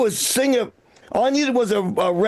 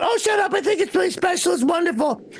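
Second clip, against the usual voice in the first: high-pitched imitation of a gushing woman; gushing girlfriend; 7 semitones higher than usual. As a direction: mimic her delight, high, easing down. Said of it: "The girl's going".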